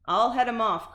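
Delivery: loud eager call; gym teacher cry. A narrator's reading. Gym teacher joining the chase. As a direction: charge ahead. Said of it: "cried the gym teacher".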